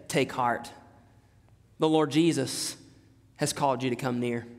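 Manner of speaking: low, soft, tender summons; hushed comfort, pitched well down; gentle reassurance. Retold or imitated. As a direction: quietly encourage someone to come. Disciples gently calling the blind man.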